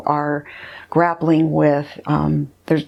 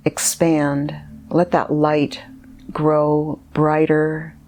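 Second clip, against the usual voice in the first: guided meditation. Acted. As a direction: guide the listener gently inward, leaving space between phrases.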